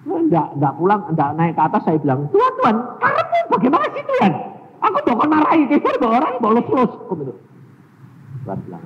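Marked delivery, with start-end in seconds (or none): 2.3-6.9 high loud protesting whine; exasperated complaint. Retold or imitated. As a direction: protest to God. Complaining to God. (protes kesal kepada Tuhan)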